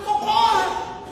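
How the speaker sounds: high, drawn-out yell for help; cry for help. Shown as a demonstration. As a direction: call out as if in real danger. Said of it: "É criar gritos que vai gritar".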